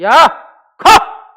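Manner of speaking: explosive high shouted command; commanding yell, very loud; forceful casting out. Shown as a demonstration. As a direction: drive the demon out with two sharp shouts. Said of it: "이렇게 강하게 쫓아낸다고 말합니다".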